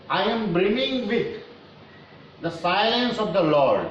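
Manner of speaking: slow, measured verse reading; poem recitation. Real, not acted. Teacher reads poem aloud before explaining, slow recitation. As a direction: recite it with long, drawn-out words.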